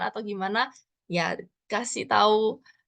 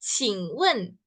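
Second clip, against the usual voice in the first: slow demo; much slower than usual. Shown as a demonstration.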